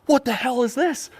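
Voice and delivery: high, hammed-up outraged shock; mock shock. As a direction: gape at the screen in mock horror. Shocked at video.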